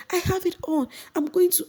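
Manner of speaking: very high shrieking protest; mock her high defiant insistence; imitating an upset housemate; defiant Mercy. Mercy insisting, mic on head.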